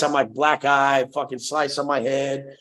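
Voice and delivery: usual speaking voice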